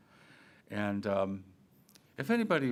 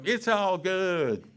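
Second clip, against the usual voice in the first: breezy waiter; 7 semitones higher, 6 dB louder, much slower than usual. Retold or imitated. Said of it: "you go".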